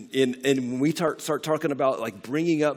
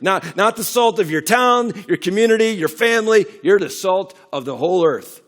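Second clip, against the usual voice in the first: rising, louder preacher's build; preaching; 5 semitones higher, 8 dB louder than usual. Acted. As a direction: climb toward the big point. Preaching at full force.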